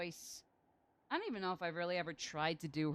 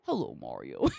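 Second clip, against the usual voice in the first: much lower, booming growl; very deep voice; 7 dB louder than usual. Acted. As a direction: drop way down for a joke greeting. Deep voice greeting Mario.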